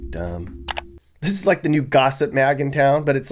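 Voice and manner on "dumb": laughing whisper. Real, not acted. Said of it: "laughing dismissal".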